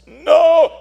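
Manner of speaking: put-on high, loud stubborn refusal in a boy's voice; defiant boy. Priest retelling the boy's refusal, high defiant boy voice. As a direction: snap back like a scared teenager.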